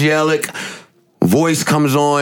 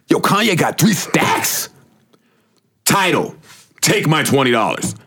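hyped; 3 semitones higher than usual. Retold or imitated. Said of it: "He said".

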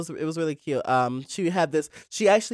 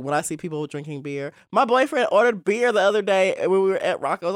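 ordinary talk, nothing marked

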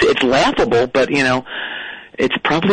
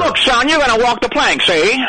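higher gangster voice with see; old-movie mobster, pitched up; thirties mob guy; 8 semitones higher than usual. Acted. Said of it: "like a thirties mob guy".

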